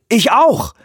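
hoch und laut vor Schreck; stoß es erschrocken heraus; erschrockener Ausruf. Acted. Erschrockene Antwort Weavers. (erschrockener, hoher Ausruf)

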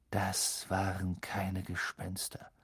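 slow, ominous whisper. A narrator's reading. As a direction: breathe it slowly under the breath. Ominous realisation in dark house.

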